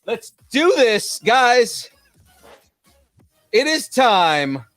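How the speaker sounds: high, drawn-out announcer call; grand announcement. Pretend. Host heralding the trailer, grand announcement. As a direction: proclaim it, stretching the last word.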